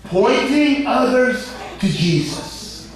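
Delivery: high-pitched, ringing sermon delivery; emphatic preaching. Real, not acted. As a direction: proclaim it with soaring stressed words. Preacher at full force.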